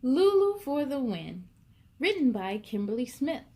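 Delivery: usual speaking voice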